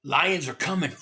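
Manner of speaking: ordinary talk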